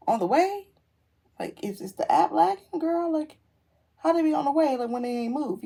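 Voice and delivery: high-pitched, exasperated questioning; incredulous. Retold or imitated. Car owner questions the tracking app, incredulous. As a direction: demand an explanation in disbelief.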